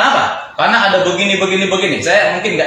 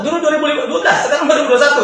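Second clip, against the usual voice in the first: quoting the Korean higher and faster, laughing through it; mocking the boastful Korean; 8 semitones higher, faster than usual. To his listener, mimicking the Korean partner's new boldness, high and quick with a laugh. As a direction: bounce it up, fast and amused. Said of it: "sekarang udah nggak takut".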